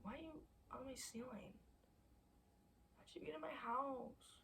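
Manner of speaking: very soft murmur at the bug; muttering. Real, not acted. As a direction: mutter it under your breath.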